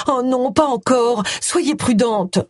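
high, drawn-out worried plea; suppressed alarmed plea. A narrator's reading. Vicar's wife silently warns her friend, alarmed plea. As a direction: plead as if holding back a cry. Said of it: "une forte envie de crier dans le combiné".

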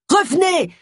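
high urgent yell. A narrator's reading. Bill yelling at Agatha to come back, high urgent shout. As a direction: shout her back in alarm. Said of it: "hurla Bill".

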